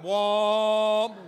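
loud, held-out amazed whoa; awed whoa. Retold or imitated. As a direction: stretch out the amazement. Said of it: "and that".

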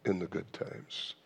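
ordinary talk

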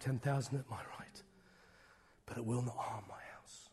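hushed unvoiced scripture recital; whispered psalm. Real, not acted. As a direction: breathe the promise softly.

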